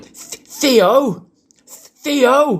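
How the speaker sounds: high, loud dog-owner call; shouting in a park. Shown as a demonstration. Imagined owner calling Theo in a park, shouting. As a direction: call the dog across a park, pitch leaping up. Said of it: "shouting Theo in a park".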